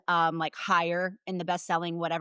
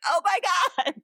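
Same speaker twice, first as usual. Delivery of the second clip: excited; 10 semitones higher, 3 dB louder, faster than usual. Retold or imitated. Reacting to the news, retold.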